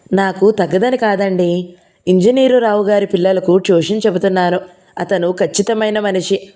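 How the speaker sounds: ordinary delivery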